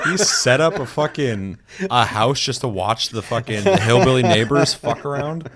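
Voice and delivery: loud, laughing incredulity; mock outrage with a laugh in it; retold disbelief. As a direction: ask it loudly, amused and baffled. Retold disbelief at friend's watching shack.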